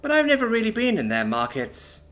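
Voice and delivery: laid-back, self-assured loner; cool mechanic voice. Acted. Brandy answers about mates.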